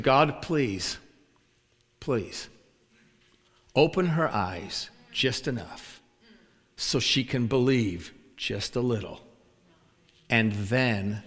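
soft prayer with long pauses; retold prayer. Praying with a hand on her shoulder, gentle retold prayer. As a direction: pray it slowly and gently, imitating him. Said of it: "this is what he prayed".

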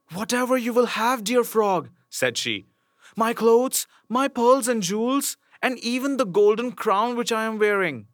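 high, eager princess voice. Acted. Princess bargaining with the frog, high eager voice. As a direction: offer everything in a rush, pleading.

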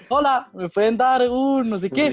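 a hint of a sweetly begging partygoer; mild mock sweet request at the bar; cheerful guy asking. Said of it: "llegaba todo feliz a pedirme tragos".

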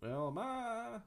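high Flintstone-style yell; Fred Flintstone. Retold or imitated. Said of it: "How do you do that?".

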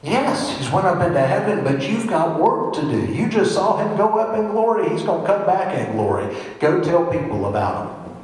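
high, emphatic acted exhortation; animated urging. Voicing the angels urging the disciples.

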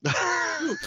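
high, incredulous echo of the name; a shocked, high-pitched repeat; surprised squeal. Real, not acted. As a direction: squeak the name back in disbelief. Player reacts to goblin's name.

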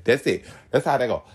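ordinary talk

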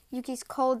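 ordinary delivery

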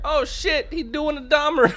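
high excited bystander yelp; bystander quote. Retold or imitated. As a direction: blurt it like a shocked onlooker. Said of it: "Someone falls into a seizure".

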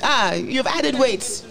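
high-pitched mock Nigerian remarks; imitated Nigerian accent. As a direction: mimic blunt Nigerian relatives. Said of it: "Nigerians would just say".

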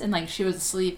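normal talking voice

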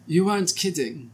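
high-pitched German Fraulein voice; retry it as an old German Fraulein; German Fraulein accent. Acted. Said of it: "That's close".

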